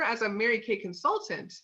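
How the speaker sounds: usual speaking voice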